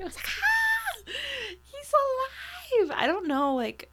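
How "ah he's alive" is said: high, loud gasp of shock; mock scream. Viewer seeing the dead man alive, screaming in mock shock. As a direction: re-enact a startled scream of discovery. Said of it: "I screamed".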